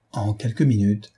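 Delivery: ordinary delivery, nothing marked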